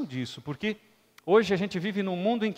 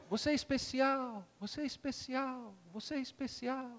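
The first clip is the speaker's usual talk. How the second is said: higher, ever softer echo; 6 semitones higher, 9 dB quieter than usual. Acted. The mountains echo the father's praise, fading echo. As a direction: let it fade like an echo. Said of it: "E o eco".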